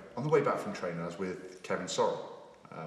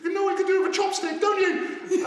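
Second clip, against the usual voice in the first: much higher furious shout; Tracy shouting; 8 dB louder than usual. Retold or imitated. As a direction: yell at him down the phone. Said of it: "all I can hear is".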